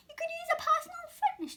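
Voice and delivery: fast high whisper; whispered pitch. Acted. Arnie reading his list.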